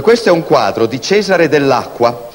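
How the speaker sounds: ordinary delivery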